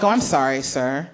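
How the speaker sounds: dry fake sorry, trailing down; sarcastic apology. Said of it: "I was like".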